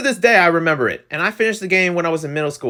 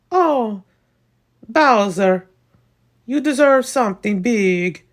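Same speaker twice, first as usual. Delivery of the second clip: high-pitched, drawn-out cartoon plumber; Mario voice; 8 semitones higher, slower than usual. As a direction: put on a high Italian game-hero voice. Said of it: "That's my Mario voice".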